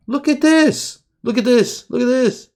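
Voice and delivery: squealed far up in pitch; delighted squeal at a perfect trend; high amazed "look at this". Real, not acted. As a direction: gush over how clean it is. Marvelling at clean chart.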